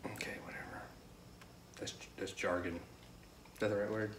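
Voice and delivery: whispered dismissive aside. Real, not acted. Brushing off the Spanish mistake, a whispered aside. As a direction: breathe it under your breath, dismissive.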